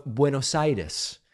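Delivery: Buenos Aires as BWE-nos EYE-des, tapped r; slow, native Spanish Buenos Aires; Spanish pronunciation. Shown as a demonstration. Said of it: "like when I will say".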